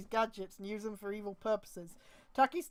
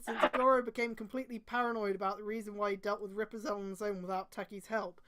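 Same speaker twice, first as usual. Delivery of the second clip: ordinary delivery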